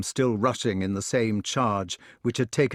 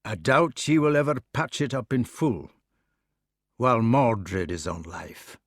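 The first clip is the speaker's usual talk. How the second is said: Scots knight speaking slowly and gravely; a hint of Scots, downcast; slow doubtful Scots. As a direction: brood over it in a Scots burr. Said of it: "He spoke slowly with his eyes on the floor".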